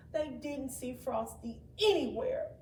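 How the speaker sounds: plain reading voice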